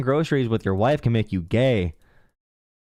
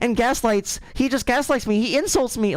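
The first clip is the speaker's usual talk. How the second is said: high whiny mock voice, much higher; mocking whiny caller; 11 semitones higher than usual. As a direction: mimic a caller complaining about being insulted. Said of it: "mocking the caller's complaint".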